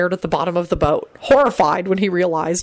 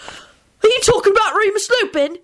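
scathing. Acted. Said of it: "in a scathing tone".

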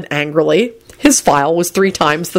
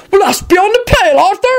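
high-pitched indignant shout; furious shout; 3 dB louder than usual. A narrator's reading. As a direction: shout it in outrage. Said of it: "Hagrid shouted furiously".

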